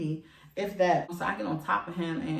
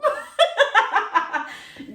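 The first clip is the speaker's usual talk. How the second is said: loud, high cackling laugh; real laughing fit; 9 dB louder than usual. Real, not acted. Teller cracking up at her own meek reply, laughing hard. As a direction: burst out in high, rapid cackles.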